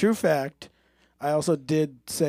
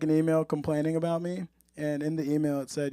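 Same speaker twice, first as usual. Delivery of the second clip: usual speaking voice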